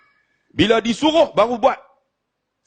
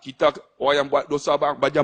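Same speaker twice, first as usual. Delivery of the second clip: usual speaking voice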